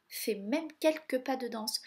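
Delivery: ordinary delivery, nothing marked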